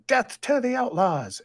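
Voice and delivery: high, loud proclamation; acted yell. A narrator's reading. Man at the lectern condemning the outlaws, acted yell. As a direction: yell it out high and slow like a decree. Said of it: "The man at the lectern yelling".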